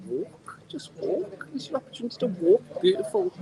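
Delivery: high, strained plea with uneven volume; exasperated plea. Real, not acted. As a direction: squeak out an exasperated appeal.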